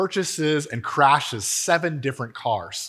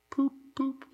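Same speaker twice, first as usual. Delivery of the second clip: soft, high-pitched dreamy toots; faint murmur; 13 semitones higher, 8 dB quieter than usual. A narrator's reading. Narrator voicing Toad sitting dazed in the road, faint high murmur. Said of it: "he faintly murmured".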